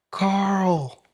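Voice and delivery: calling the name up high and drawn out; playful call out. Acted. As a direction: sing out the name to start the clip. Reactor calls out to comedian.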